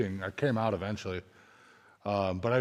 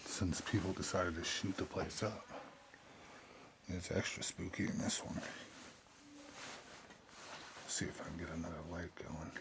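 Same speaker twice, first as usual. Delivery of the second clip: nervous night vlog whisper; 4 semitones lower, 14 dB quieter than usual. Real, not acted. Alone in spooky building at night.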